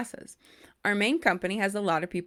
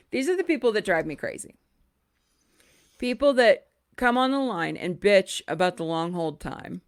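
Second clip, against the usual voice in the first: normal talking voice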